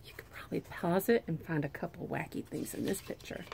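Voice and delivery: soft whispered aside. Real, not acted. Teacher whispers a hint to her pre-K class, softly.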